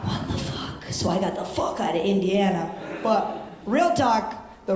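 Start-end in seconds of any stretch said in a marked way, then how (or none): 0.0-0.8 breathy hushed swear; stunned whisper to the crowd; whispered disbelief. Real, not acted. Disbelief aside.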